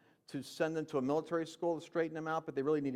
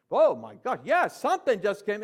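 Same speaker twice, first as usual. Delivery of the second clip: loud amazed exclamation; 6 semitones higher, 9 dB louder than usual. Retold or imitated. Retelling his conversion to the audience, loud and amazed. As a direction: burst out loud, overwhelmed by what just happened.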